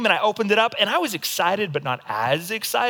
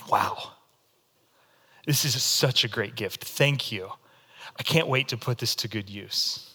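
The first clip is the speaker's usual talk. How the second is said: lower, muted polite thanks; flat outward thanks; 7 semitones lower, 6 dB quieter than usual. Pretend. Polite outward reaction to a great gift, flat. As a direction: thank them with no zeal. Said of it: "and I go".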